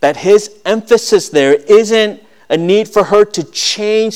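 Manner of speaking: high, loud preaching voice; impassioned preaching. Acted. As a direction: preach it out to the whole church. Preaching at full force.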